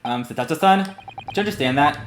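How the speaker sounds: ordinary talk, nothing marked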